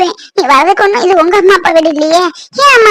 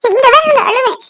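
building higher into a wail; defiant crying; 6 semitones higher than usual. Acted. Wife insists she will cry right here, defiant wailing. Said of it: "இன்னும் சத்தம் போட்டு கூட அழுவேன்".

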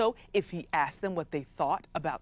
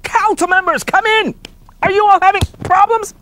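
chief shouting for council; 13 semitones higher, 14 dB louder than usual. Acted.

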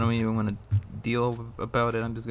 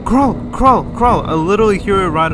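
high, loud barked repeats; frantic urging; 6 semitones higher, 11 dB louder than usual. Real, not acted. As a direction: shout each word to hurry it along. Urging character on as monster nears.

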